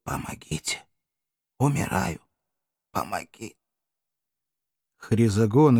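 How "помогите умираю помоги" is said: very soft, failing croak; barely audible rasp. A narrator's reading. Exhausted killer collapses and begs for help, barely audible rasp. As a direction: gasp for help with almost no voice. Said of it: "еле слышно прохрипел".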